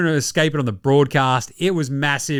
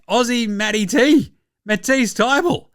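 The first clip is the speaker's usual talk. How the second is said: ordinary talk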